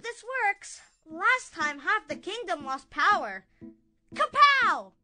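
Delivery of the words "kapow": loud comic wha-bam; punchy cartoon blast; magic zap sound effect.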